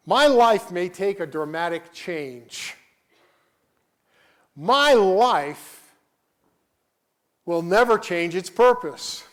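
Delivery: loud, ringing pulpit proclamation, slowing on the key words; preaching at full force. Acted. Pastor proclaiming to his church, loud full-force preaching. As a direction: thunder it out to the congregation.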